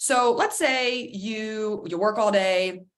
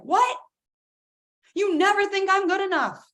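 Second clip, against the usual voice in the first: high, loud whiny protest; higher, louder indignant teen cry; indignant outburst; 11 semitones higher, 4 dB louder than usual. Retold or imitated. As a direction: bristle like a hurt teen. Child retorting to parent.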